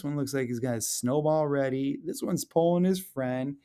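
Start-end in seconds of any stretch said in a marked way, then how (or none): none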